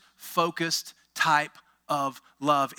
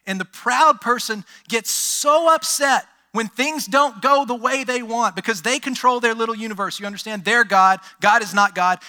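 rising, louder preacher build; preaching; 3 semitones higher, 4 dB louder than usual. Acted. Pastor preaching to his church, loud and building. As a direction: drive the point home to the congregation.

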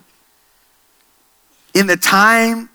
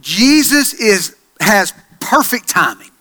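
ordinary talk

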